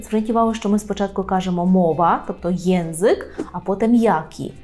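ordinary talk

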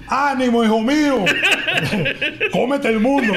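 high, laughing mock cheer; hammed-up airport mother cheering; mock mother's shout. Recalling a mother yelling to her son at the airport. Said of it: "la señora que gritó".

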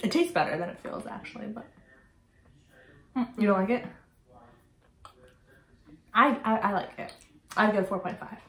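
chewing between words; muffled closed-lip speech; mouth full while talking. Real, not acted. Seen 3: Right speaker eats at -3/-2, then talks with pursed, chewing mouth.

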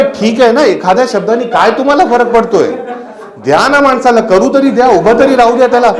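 loud, high protest; exasperated plea. Retold or imitated. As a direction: plead for a chance, fed up. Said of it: "अरे, म्हटलं".

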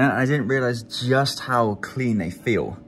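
lip pulled down by finger; speaking with teeth bared. Real, not acted. Seen 3: Finger presses and pulls lower lip down, teeth bared, throughout.

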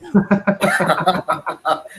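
real chuckling laugh. Real, not acted. Co-host amused by the echo problem, laughing.

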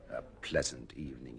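deflated, sarcastic murmur; dry sarcasm. Acted. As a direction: mutter the phrase back with irony. Sour echo of her promise.

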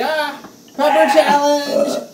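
high, slow, hammed-up pepper hype; fake excitement. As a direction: ham up a fake thumbnail cheer. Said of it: "fake it and just be like".

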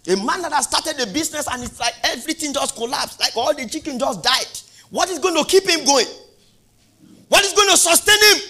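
high, loud, rising fervent preaching; preaching at full force. Acted. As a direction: preach it harder with each line.

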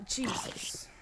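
breathy startled whisper; a shaken whispered aside. Real, not acted. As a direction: hush it out after a near fall. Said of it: "startled whisper after near fall".

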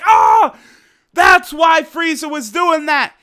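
high, loud shout of realization; startled realization. Retold or imitated. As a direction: gasp it out like a sudden insight. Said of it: "Roshi comes in like".

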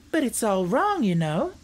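earnest, high-pitched objection. A narrator's reading. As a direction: protest earnestly as a young girl. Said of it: "declared Dorothy earnestly".

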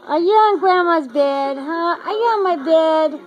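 high, sing-song puppy talk. Real, not acted. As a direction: coo at the puppies on the bed. Grandma talks to puppies.

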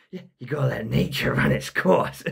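a hint of a put-on character. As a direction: toss it off as the cameraman. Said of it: "I just played that part straight away".

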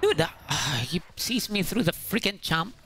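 ordinary talk

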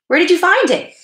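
put-on boy's excited high-pitched query; curious. A narrator's reading. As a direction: pipe up with keen curiosity. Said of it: "Keith was curious to know".

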